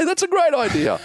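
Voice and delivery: put-on high, far-off kid voice, faking big approval; a kid gushing over a silly idea, hammed up; mock enthusiastic kid. Kid mocking a friend's purse plan, high mock cheer.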